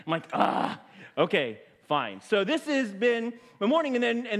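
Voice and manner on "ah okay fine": slow, drawn-out groan sinking low; grudging acceptance. Retold or imitated. Pastor told he can't return the speaker, grudgingly resigned. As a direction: give in slowly, mock-deflated. Gives in after being corrected.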